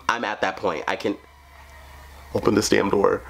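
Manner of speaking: normal talking voice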